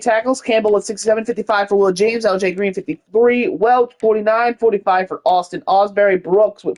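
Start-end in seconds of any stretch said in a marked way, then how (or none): none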